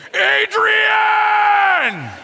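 high, loud desperate shout, laughing; screaming Adrian. Retold or imitated. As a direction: scream her name across the ring. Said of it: "he's screaming her name".

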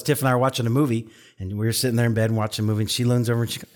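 ordinary delivery

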